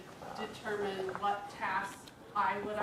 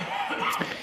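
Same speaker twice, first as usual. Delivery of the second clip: word breathed out through a loud laugh; 9 dB louder than usual. Real, not acted. Wife finishing her lawn story, laughing the word out.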